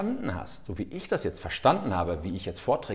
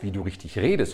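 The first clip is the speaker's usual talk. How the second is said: ordinary talk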